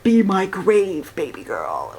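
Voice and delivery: mock seductive. Reader parodying the love interest, hammed-up sultry. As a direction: drop way down and purr it as a joke. Mocking the book's romance line.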